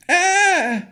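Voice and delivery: high, loud shrieking scream; hag scream. Acted. The hag hearing her name, loud high scream. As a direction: let out a piercing crone's shriek. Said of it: "the creature screamed".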